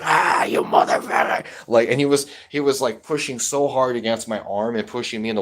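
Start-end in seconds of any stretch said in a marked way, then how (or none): 0.0-1.4 high, loud, rasping imitation of a raging grandpa; in-your-face yell. Grandson imitates grandpa lunging at his face, raging yell. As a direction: yell it right into someone's face, mock-enraged. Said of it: "like, close like this".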